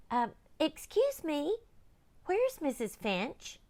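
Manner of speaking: put-on high, slow polite boy; high, slow child's voice; young boy. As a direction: ask politely like a small boy.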